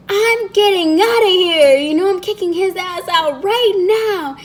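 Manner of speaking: high-pitched, worked-up rant; retold outrage. Retold furious call to mom.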